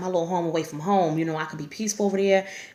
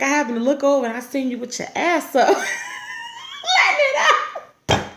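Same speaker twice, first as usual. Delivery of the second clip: shouted through laughter, much higher; laughing while quoting; 9 semitones higher, 3 dB louder than usual. Retold or imitated. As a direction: break up mid-quote. Quoting him while laughing hard.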